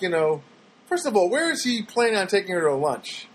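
ordinary talk, nothing marked

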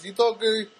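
put-on high, broken English with a Spanish accent; exaggerated Spanish-accented patter; imitated Spanish accent. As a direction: mimic a Spanish speaker struggling with English. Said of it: "that's how he talks".

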